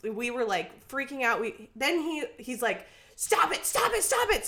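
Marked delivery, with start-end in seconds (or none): 3.1-4.5 high, urgent repeated yelling at the passenger; retold yelling. Cab driver yelling at the passengers to stop, shouting. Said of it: "So it's, like, yelling at me".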